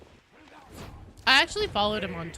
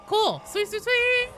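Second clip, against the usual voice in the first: higher and louder, gushing sweet sweet sweet; excited; 13 semitones higher, 7 dB louder, slower than usual. Real, not acted. Streamer thrilled by a game drop, high excited squeal. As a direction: squeal it out with delight.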